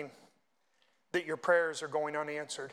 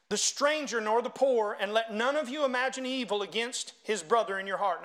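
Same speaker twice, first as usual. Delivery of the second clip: raised, ringing scripture reading; preached scripture reading; 4 semitones higher, 4 dB louder than usual. Acted. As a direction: read scripture out forcefully to a congregation. Reads scripture aloud to congregation.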